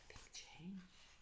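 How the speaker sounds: hushed whisper. Acted. Storyteller hinting a change, whispered. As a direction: breathe it out softly. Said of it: "aside to children".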